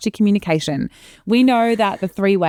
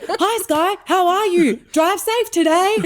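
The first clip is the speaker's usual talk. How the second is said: much higher, hammed-up voicing of dad's all-caps text; 13 semitones higher, slower than usual. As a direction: read dad's shouty capital-letter text in a squeaky mock voice. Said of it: "he only texts in capitals".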